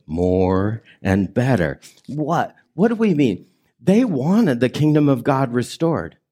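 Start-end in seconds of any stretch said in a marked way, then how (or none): 0.1-1.8 slow, drawn out, every word spaced; slow congregational repeat. Shown as a demonstration. Preacher leading the congregation in a slow repeat. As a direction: lead the room through it slowly. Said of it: "Say that with me again".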